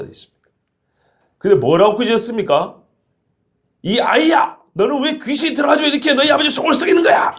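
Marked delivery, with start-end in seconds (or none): 3.8-7.4 mock scolding. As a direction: play a nagging scold, much higher. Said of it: "이렇게 꾸짖은 게 아니고".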